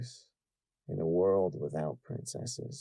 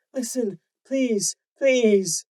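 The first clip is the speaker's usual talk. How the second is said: high, urgent, slower pleading; frantic begging; 8 dB louder, much slower than usual. Acted. Mother begging her daughter frantically. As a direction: beg her desperately. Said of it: "her mother begged frantically".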